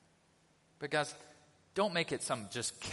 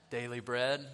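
ordinary talk

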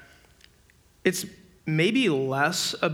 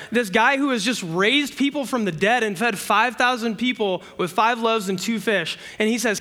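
normal talking voice

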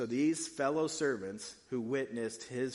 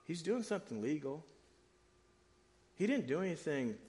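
usual speaking voice

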